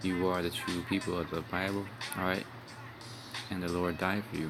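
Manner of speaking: usual speaking voice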